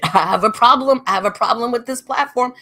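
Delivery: a mild mock yell, fast on the first words; half-shouted complaint, retold with a laugh; mock scream. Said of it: "This is what I start screaming".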